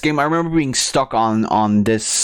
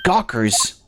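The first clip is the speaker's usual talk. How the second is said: ordinary delivery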